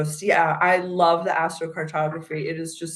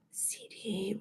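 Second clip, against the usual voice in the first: whisper; 3 semitones higher, 16 dB quieter than usual. Real, not acted.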